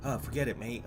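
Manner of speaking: soft, garbled rambling under the breath; mock incoherent mumbling. Imitating a rival nobody could hear, soft rambling mumble. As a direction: mumble nonsense so nobody can follow. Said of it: "That's how he sounded".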